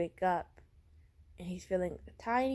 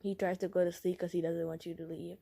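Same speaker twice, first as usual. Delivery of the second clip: ordinary delivery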